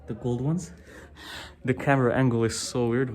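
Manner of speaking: ordinary talk, nothing marked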